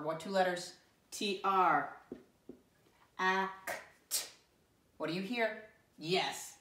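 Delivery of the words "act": "Act" broken into separate sounds; sounded out. Shown as a demonstration. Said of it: "sound this word out".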